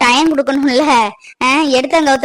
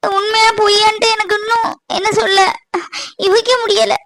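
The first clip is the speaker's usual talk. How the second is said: high, sobbing, tearful pleading; a woman crying at her lover's cruel words; heartbroken crying; 8 semitones higher than usual. Acted. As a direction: weep as every word breaks your heart.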